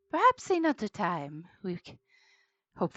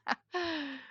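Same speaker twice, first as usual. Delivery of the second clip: laugh; 10 semitones higher, 5 dB quieter than usual. Real, not acted. Player laughs at the scene.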